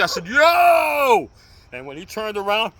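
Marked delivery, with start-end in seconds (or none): 0.3-1.3 loud high shout; yell. Retold or imitated. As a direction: bellow it into his ear. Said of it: "I screamed in his ear".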